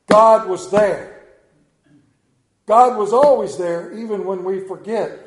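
booming sermon emphasis; preaching at full force. Real, not acted. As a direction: declare it with all your weight.

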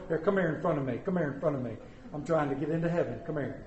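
mock eager invitation. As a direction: coax them in front with a sheepish laugh. Pastor acts waving ladies ahead.

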